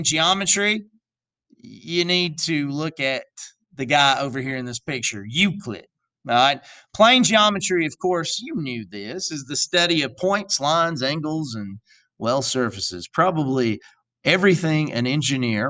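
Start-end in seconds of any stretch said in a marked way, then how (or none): none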